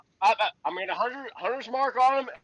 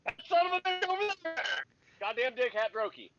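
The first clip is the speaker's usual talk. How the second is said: hollering; 5 semitones higher, 5 dB quieter than usual. Acted.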